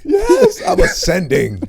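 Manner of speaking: loud, high comic rapture; hammed-up fanboy elation; starstruck ecstasy. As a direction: cry out as if floating to heaven.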